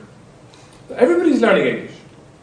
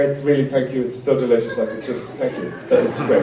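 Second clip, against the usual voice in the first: softer, trailing fake-gushing thanks; forced politeness; 7 semitones lower, 7 dB quieter than usual. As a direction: gush politely while clearly hating it. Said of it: "How's your muesli this morning".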